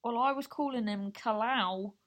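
ordinary delivery

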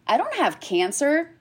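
high-pitched, baffled protest; retold disbelief. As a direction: act out baffled denial, pitch jumping up. Retold disbelief at oncologist referral.

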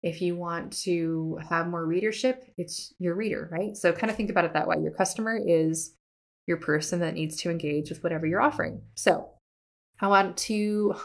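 ordinary talk

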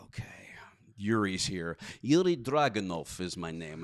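lightly voiced Russian accent; a hint of Russian accent. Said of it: "doing Russian accent".

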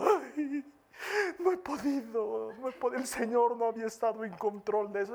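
lightly voiced mock whimper; mock crying. Heartbroken believer crying to pastor, mild mock lament. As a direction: whine that you couldn't do it.

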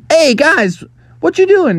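high, loud friendly hail; calling out. Acted. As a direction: call brightly over to the kids. Frog calls to the ducklings.

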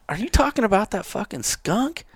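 high, rising disbelieving question; retold incredulity. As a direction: replay your disbelief at a clueless buddy. Retold incredulous question about skunk.

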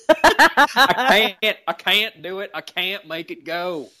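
hammed-up Kentucky accent with drawn-out vowels; Kentucky Scotty. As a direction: plead helplessly in a mock Kentucky twang. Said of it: "but it was from Kentucky".

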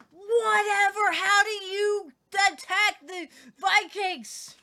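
loud, high, drawn-out disbelief; exaggerated exclamation of disbelief; incredulous outburst. Real, not acted. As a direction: stretch each word out, loud and high, astonished. Mock outrage at Iceland raid.

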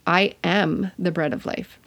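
"am" held and leaned on, rest even; stress on AM. Shown as a demonstration. Teacher showing how emphasis changes a verse, stressing "am". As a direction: put the weight on "am". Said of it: "emphasize one different word each time".

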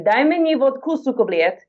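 by request, in Russian, to the host; Russian phrase. Shown as a demonstration. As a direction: say it like a native Russian. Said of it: "Let me hear your Russian".

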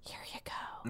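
breathy, soft whispered hand-off; whispered throwaway. Acted. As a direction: drop to a whisper. Mock handing over plot points.

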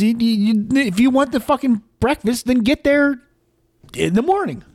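exasperated telling-off; exasperated scolding. Real, not acted. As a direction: tell him off, pitch climbing. Scolding the breakfast customer.

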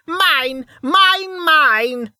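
put-on very high, loud old woman's shriek; possessive rage. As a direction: shriek each word, furiously possessive. Raging old woman claims her house.

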